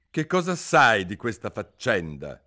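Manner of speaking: king interrogating Alice; king's voice. A narrator's reading. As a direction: demand an answer from the witness.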